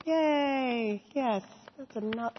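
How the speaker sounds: a light cheer of delight; cheery yay. Real, not acted. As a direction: celebrate the end of the story. Cheering after the story.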